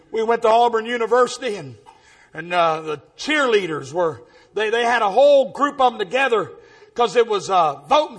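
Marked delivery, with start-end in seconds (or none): none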